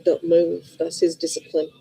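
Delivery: ordinary delivery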